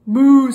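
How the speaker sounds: big high mooing cow, loud. Acted. Reading to kids, cow mooing loudly. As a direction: belt out a cow's moo.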